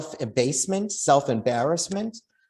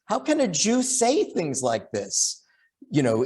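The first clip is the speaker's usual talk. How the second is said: usual speaking voice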